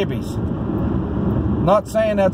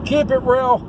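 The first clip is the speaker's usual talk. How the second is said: higher, hammed-up sign-off warning; playful mock threat; 8 semitones higher than usual. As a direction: tease them with a fake threat.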